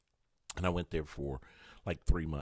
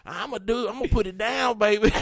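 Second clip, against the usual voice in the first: a hint of a cocky lover; lightly voiced bragging hookup guy; mock smooth player; 8 semitones higher than usual. Mocking himself as a cocky hookup.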